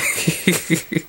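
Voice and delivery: high, voiceless giggle. Real, not acted. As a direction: giggle helplessly to yourself. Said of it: "It always makes me laugh".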